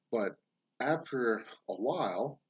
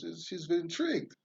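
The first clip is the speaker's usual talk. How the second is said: ordinary talk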